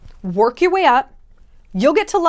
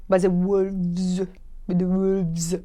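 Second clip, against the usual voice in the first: put-on slow, lower French accent, relishing it; 4 semitones lower, 4 dB quieter, much slower than usual. Teasing viewers, slow lower French accent. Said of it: "they still have a French accent".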